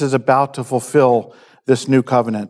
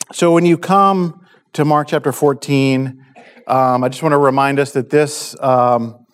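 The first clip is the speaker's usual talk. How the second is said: ordinary talk, nothing marked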